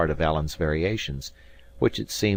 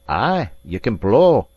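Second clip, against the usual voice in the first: grudging praise; 4 semitones higher, 7 dB louder, slower than usual. Acted. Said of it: "said Alan".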